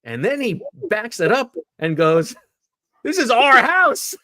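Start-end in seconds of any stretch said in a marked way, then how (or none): 3.0-4.2 much higher, louder, slow mock bark; imitated barking. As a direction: plant your feet and claim the turf. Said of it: "Howie Roseman barking at those two guys".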